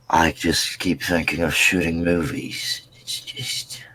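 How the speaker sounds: low, subdued indie filmmaker impression; lightly mock a brooding coffee-drinking film guy. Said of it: "the indie film guy that, like, drinks coffee and is like".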